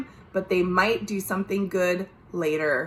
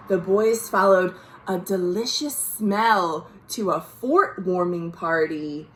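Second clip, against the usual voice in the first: plain reading voice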